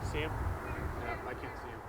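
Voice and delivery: hushed whisper. Real, not acted. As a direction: whisper so the bear can't hear. Whispering near the bear.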